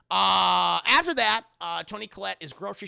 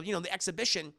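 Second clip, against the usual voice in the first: ordinary talk, nothing marked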